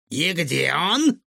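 slow, pressing villain voice; villain demanding. A narrator's reading. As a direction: drag it out like a menacing villain. (злодей медленно и с нажимом требует)

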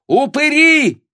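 very high, loud angry screech; shrieked insult. Acted. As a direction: fling the curse out at the top of your voice. Баба бранит господ перед толпой. (злобный визгливый выкрик)

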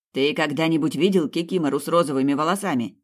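plain reading voice

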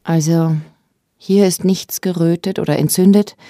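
ordinary talk, nothing marked

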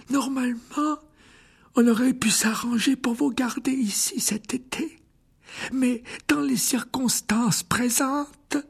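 put-on high, slow, kindly old wizard voice; an elderly headmaster gently refusing; frail old headmaster. A narrator's reading. (voix aiguë et lente de vieux directeur)

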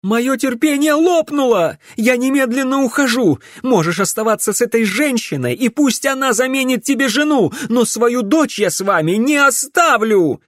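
very high, loud ultimatum; furious outburst. A narrator's reading. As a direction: shout it with patience snapped. Wife's furious outburst while leaving. (яростный крик на высоких тонах)

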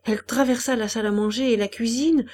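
ordinary delivery

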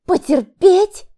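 high, loud, drawn-out outrage; woman in labour indignantly repeats her husband's advice; indignant outburst. A narrator's reading. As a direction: throw the word back at him in disbelief. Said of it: "возмутилась она".

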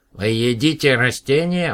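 high, loud, slow horrified cry; the plant-alien aghast. A narrator's reading. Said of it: "в ужасе вскричал Потатам".

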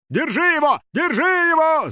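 high, urgent chase shout. Acted. Guard chasing the fugitive, shouting. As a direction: yell the order twice, high. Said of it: "закричал один из охранников".

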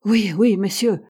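ordinary delivery, nothing marked

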